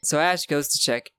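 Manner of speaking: usual speaking voice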